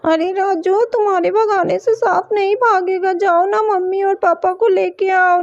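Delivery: ordinary delivery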